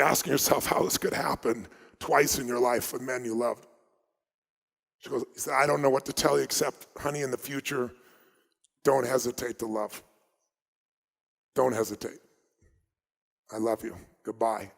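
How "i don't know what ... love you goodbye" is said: hushed, halting goodbye, sinking lower; retold tender farewell. Fiance leaving a last phone message, retold as a tender farewell.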